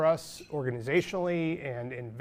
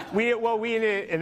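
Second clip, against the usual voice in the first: laughing; 7 semitones higher, slower than usual. Real, not acted. Laughing at rain joke.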